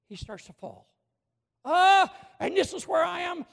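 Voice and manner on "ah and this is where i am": higher, louder cry of alarm; panicked shout. Real, not acted. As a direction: yell it as if watching him fall. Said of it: "I'm screaming inside of myself".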